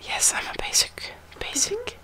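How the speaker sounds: hushed show and tell; whispering. Real, not acted.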